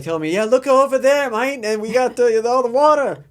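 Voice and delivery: put-on high, loud hammy Australian accent; a terrible Aussie bloke impression, loud and high; bad Australian accent. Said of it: "I do a terrible Australian accent".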